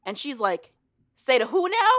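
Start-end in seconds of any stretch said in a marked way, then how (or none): 1.2-1.9 high, loud mock disbelief; acted-out outraged double take; incredulous. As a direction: blurt it out as an incredulous retort. Retold heroine challenges a murder confession.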